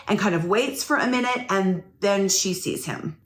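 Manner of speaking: normal talking voice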